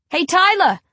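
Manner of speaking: calling out loud across the playground; shout. A narrator's reading. Narrator shouts at Tyler across the playground, loud call. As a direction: yell his name to get his attention. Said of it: "I shout".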